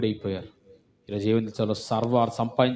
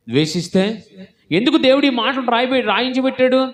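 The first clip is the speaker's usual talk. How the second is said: higher and louder, building fervent sermon; preaching at full force; 9 semitones higher, 5 dB louder than usual. Acted. Preacher warns the congregation, building to a fervent shout. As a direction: thunder it out to the church.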